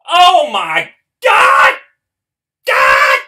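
shrieking at the top of the lungs; full-throated scream over a rare pull; ecstatic scream. Real, not acted. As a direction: scream with disbelieving joy. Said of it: "me screaming was just in my ears".

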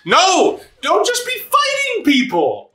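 real shout. Real, not acted. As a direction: shout it at the screen, way up high. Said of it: "scolding Eddie louder".

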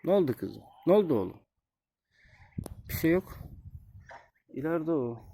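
high, gentle cooing voice; talking to goslings. Real, not acted. Soothing goslings, all is fine.

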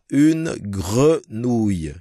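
une grenouille drawn out slowly, gre gargled; teacher modelling une grenouille slowly; slow French model. Shown as a demonstration. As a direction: stretch une grenouille syllable by syllable. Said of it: "cái khó khăn là cái chữ gre".

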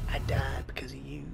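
unvoiced blaming whisper. Real, not acted. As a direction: hiss the blame quietly. Mock blame after dying.

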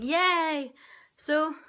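ordinary talk, nothing marked

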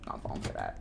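very soft, voiceless aside; hushed muttering. Real, not acted. Streamer refusing to fall for a trick, barely audible mutter. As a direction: mutter it almost silently to yourself.

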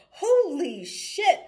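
high, loud and drawn out, imitating an excited guy; excited exclamation. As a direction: stretch "Holy shit" out slowly in a stranger's amazed voice. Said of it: "He's like".

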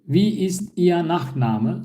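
Wie ist Ihr Nachname said slowly, each word clear; slow model question. Shown as a demonstration. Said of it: "Nachname soyadı demek". (yavaş, net örnek soru)

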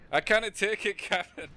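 high, loud attempt at a Scottish accent. Shown as a demonstration. Player shows the captain line to a teammate, Scottish accent. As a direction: do a hammed-up Scottish ship's engineer. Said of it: "Can you do a Scottish accent and say".

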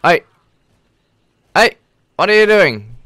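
loud, high challenge; indignant yell at the attacker; shouting. Real, not acted. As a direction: call the zombie out. Shouts at approaching zombie.

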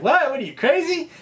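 high-pitched disbelieving retort; mock incredulous. Fisherman to a businessman asking to go out, mock incredulous. Fishermen react to absurd request.

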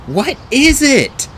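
high, loud, drawn-out question; urgent question. A narrator's reading. Boy asks about the only cure, urgent. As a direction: press hard for the answer.

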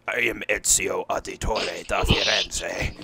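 slow, loud, low-voiced character line; imitated low-voiced trailer hero. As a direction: declaim it slowly in a low, forceful voice. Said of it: "it was, like, this low voice".

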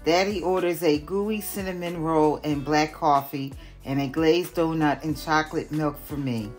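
plain reading voice

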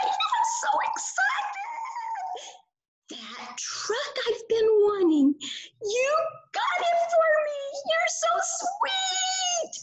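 put-on very high, squealing puppet kid voice; high puppet confession, breaking into excited squeals. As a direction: gush over the new toy, bubbling over. Puppet Burley confesses snooping excitedly.

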